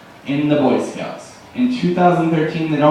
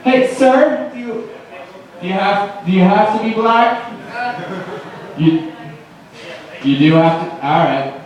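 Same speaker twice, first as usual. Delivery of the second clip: mock accusing hippie; 3 semitones higher than usual. Imagined hippie stopping strangers.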